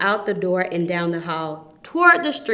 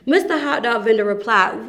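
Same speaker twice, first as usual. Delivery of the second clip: usual speaking voice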